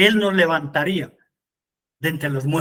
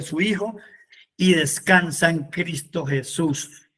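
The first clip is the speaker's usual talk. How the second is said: ordinary talk, nothing marked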